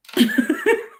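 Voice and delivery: a burst of high, giggly laughter; high delighted laugh. Real, not acted. To viewers, gushing about trees, a high delighted laugh. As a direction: laugh out in pure delight at the thought.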